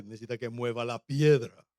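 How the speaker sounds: mock macho low voice; courting voice. Suitor offering to move the stone, courting voice. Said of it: "esa voz como que se va agotando".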